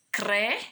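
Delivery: "Cre" alone, C and R blended; isolated syllable. Shown as a demonstration. Teacher showing the C and R together, isolated syllable. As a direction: sound out "Cre" as one syllable. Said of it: "Olha o som do C e do R juntos".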